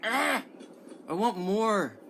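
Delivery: eager outburst. Real, not acted. Viewer reacting to game footage, eager high outburst.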